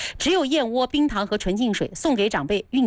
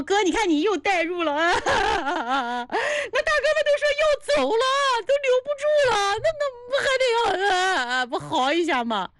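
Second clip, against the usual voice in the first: much higher, put-on sobbing voice; mock wailing; 12 semitones higher than usual. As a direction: ham up a tearful wail. Reading a viewer's comment theatrically.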